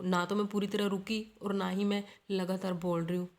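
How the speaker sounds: usual speaking voice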